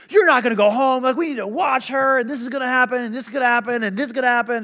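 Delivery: high, agitated doctor piling up warnings; hammed-up panicky doctor; imitated freaking out. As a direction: protest in mock alarm, listing every danger. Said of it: "doctors are freaking out".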